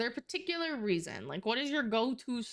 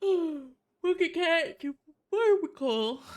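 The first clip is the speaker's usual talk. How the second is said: cooing at cat; 7 semitones higher, 6 dB louder, much slower than usual. Real, not acted. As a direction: gush over the cat, high and slow.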